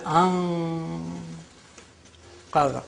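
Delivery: "an-" held nasal, pause, then "qadha"; ikhfa. Shown as a demonstration. Teacher demonstrating noon without sukoon, held nasal "an-" before "qadha". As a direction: split it as a long hidden-n "an-", then "qadha". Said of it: "because it is ikhfa".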